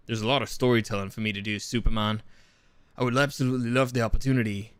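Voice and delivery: Henry Cavill. Acted. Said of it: "like, more like".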